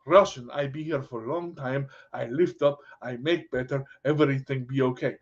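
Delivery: low-pitched put-on Russian accent. Shown as a demonstration. Coach promising to lift a student up, Russian accent. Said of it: "I can say it in any accent".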